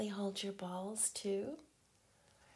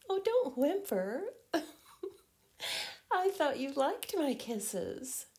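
teasing; 7 semitones higher, 9 dB louder than usual. Acted. Teasing partner, voice jumps up.

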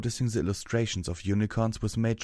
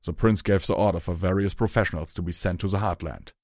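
loud, rough character voice; Hammer Chain's gruff voice; 4 dB louder than usual. Acted. Hammer Chain explains his posting to Rarity, loud gruff voice. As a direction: explain it loudly and bluntly.

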